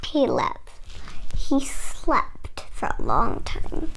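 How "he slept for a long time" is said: 1 sleepy whisper. A narrator's reading. Sleepy hush in story.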